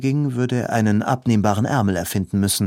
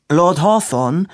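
raised high and loud, calling for attention; 8 semitones higher, 6 dB louder than usual. A narrator's reading. Robin loudly interrupting Hawthorne to get his attention. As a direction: cut in loudly with the name. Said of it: "sagte Robin laut".

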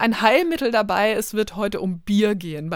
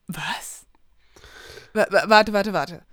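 startled; slower than usual. Real, not acted.

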